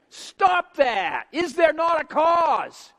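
loud, high, drawn-out challenge to the crowd; preacher's shout. Real, not acted. Preacher exhorting at full force.

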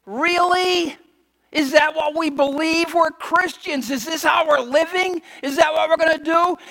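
high, loud disbelief; preacher's indignant disbelief at the congregation; incredulous. Retold or imitated. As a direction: ask as if you can't believe your ears. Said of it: "you think".

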